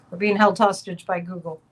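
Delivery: ordinary delivery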